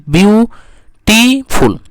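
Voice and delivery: ब्यूटीफुल in three slow, separate beats; split into syllables. Shown as a demonstration. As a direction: draw out and separate ब्यू-टी-फुल in ब्यूटीफुल. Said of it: "तीन गो साइलेबल्स इसमें आ रहा है". (ब्यूटीफुल को तीन हिस्सों में खींचकर बोलना)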